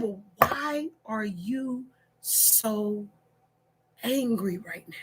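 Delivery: slow, soft whispered question. Retold or imitated. Wife whispering to angry husband. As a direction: hush him slowly.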